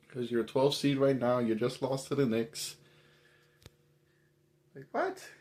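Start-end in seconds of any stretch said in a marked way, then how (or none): 4.7-5.3 high squeaky disbelieving what; stunned, can't believe it; incredulous. Real, not acted. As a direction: pitch shoots way up in disbelief. Incredulous reaction to Knicks loss.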